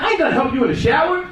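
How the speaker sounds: high, quick mock indignation; rushed, high-pitched protest; startled refusal. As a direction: blurt it fast and high, taken aback. Startled reply to misheard request.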